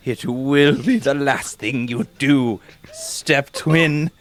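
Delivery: low villain vow. Acted. Villain twin swearing revenge.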